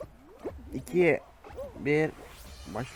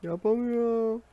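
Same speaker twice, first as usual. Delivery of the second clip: much higher, pinched cry of defeat; frustrated whine; 9 semitones higher, 3 dB louder, slower than usual. Real, not acted. Stuck gamer whining that he can't pass this part. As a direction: squeal it like you give up. Failing a game level.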